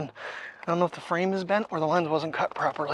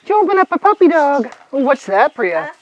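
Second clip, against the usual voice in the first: loud, very high doting questions; playful high-pitched fussing; excited pet talk; 8 semitones higher, 12 dB louder than usual. Real, not acted. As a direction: gush at the dog in a high, loud voice. Asking dog about opened toy.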